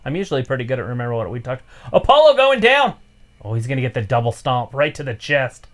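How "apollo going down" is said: loud excited play-by-play; wrestling commentator. Real, not acted. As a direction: call the big move. Live call of the match.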